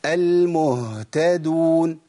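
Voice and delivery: very slow "المهتدون" with an extended h; lengthened ha. Shown as a demonstration. Teacher lengthens it so students hear, lengthened ha. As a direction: stretch the h of "المهتدون" so it is plainly heard. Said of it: "هزود الزمن شوية عشان تسمعوا صوتها".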